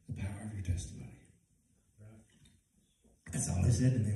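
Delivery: quiet reflective preaching. Acted. Preacher quietly urging listeners to share their story, hushed. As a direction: pull them in close and speak gently.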